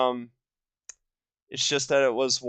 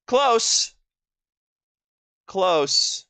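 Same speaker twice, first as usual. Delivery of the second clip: excited; 7 semitones higher, 4 dB louder than usual. Real, not acted. Reacts to guess result.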